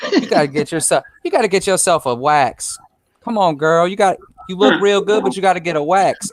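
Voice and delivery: sassy retold auntie voice; older lady. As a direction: play her coaxing a girlfriend with blunt advice. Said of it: "this older lady".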